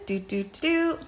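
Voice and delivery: usual speaking voice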